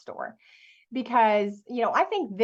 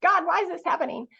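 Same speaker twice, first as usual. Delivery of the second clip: higher, half-sobbed wail of failure; mock bawling to mom; 7 semitones higher than usual. Calling mom from the empty store, a retold tearful wail. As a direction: replay the tearful call, pitched up. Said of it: "I'm bawling".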